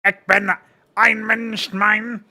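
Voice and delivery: loud, high mock-German rant; Hitler impression. Shown as a demonstration. Streamer showing off his limited German again, shouted Hitler impression. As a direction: shout a caricature of German oratory. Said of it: "just like a Hitler impression".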